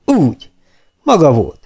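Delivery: sergeant's rejoicing discovery; delighted. A narrator's reading. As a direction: sound glad to have found him. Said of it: "mondja az őrmester örvendezve".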